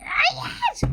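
a squealed no, way up high. Acted. Squealing no at the shelf. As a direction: squeak the refusal out. Refusing again.

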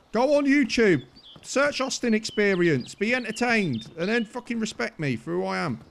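loud, high, indignant demand; indignant shout. Real, not acted. Telling his attacker to look him up, indignant shout. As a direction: bark the orders at him.

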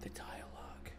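breathy whisper of The dialogue. Real, not acted. Repeats phrase in awe.

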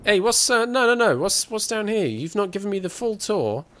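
sudden high alarmed shout; surprised. Real, not acted. Player stumbling on a hidden room, surprised. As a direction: blurt it out, caught off guard.

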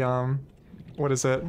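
ordinary talk, nothing marked

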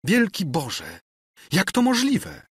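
high-pitched, appalled disbelief; shocked exclamation. A narrator's reading. Princess hears Ksawery's confession, shocked high exclamation. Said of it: "zawołała księżna".

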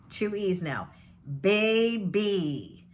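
ordinary talk

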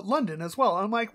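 ordinary talk

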